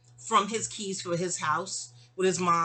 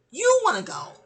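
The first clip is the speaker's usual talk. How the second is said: imitating herself snapping back at him, higher and louder; mock heated comeback, pitched up and loud; retold exasperated yell; 11 semitones higher, 8 dB louder than usual. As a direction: throw the blame back at him, raised and high. Retold argument with baby daddy.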